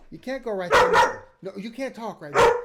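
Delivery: loud stern scolding; scolding the dog. Real, not acted. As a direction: tell the dog off firmly. Scolding the dogs on camera.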